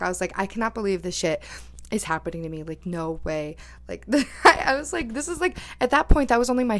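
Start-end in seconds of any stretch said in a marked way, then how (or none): none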